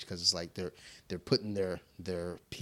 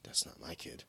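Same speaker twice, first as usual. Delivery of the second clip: whispered aside; 13 semitones higher, 4 dB louder than usual. Retold or imitated. Dad whispering his thought while watching gym kids. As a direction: whisper it to yourself. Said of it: "I'm like".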